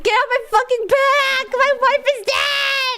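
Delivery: crying, shouting in anguish; grieving man shout. Pretend. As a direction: yell it through fake sobs. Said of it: "Everyone's like".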